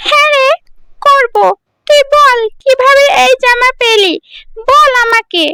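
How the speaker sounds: put-on very high, shrill-pitched taunting girl; nosy village girl. Village girl quizzing Priya about her new dress, taunting. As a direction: demand an answer like a jealous neighbour girl. Friend agrees eagerly.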